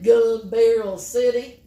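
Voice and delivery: drawled small-town Texas pronunciation; local Texas twang. Shown as a demonstration. Hosts mimicking caller's Texas twang. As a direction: say it the way the locals would. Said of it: "'Cause you gotta say it like they would".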